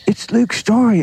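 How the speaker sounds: high-pitched, excited mock Aussie; butchered Aussie accent. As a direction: imitate a starstruck Australian fan, accent badly done. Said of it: "sorry, I'm butchering the Aussie accent".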